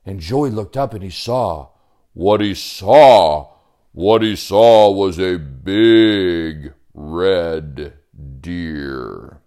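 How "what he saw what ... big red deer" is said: very slow, drawn-out suspense; dramatic reveal. A narrator's reading. Storyteller reveals the creature Joey saw, slow and suspenseful. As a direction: stretch each word, then drop low on the last.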